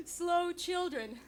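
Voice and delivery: drawn out slowly, word by word; slow sign reading. Retold or imitated. Comic reads the street sign to the audience, slowly. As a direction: read the sign slowly for the joke.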